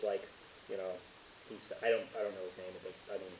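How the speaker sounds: ordinary talk, nothing marked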